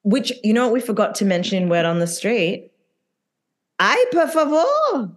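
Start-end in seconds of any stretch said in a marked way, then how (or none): 3.8-5.1 put-on high, drawn-out Spanish-accented ay por favor; playful imitation of a Spanish-speaking reality star. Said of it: "Sorry, that was racist".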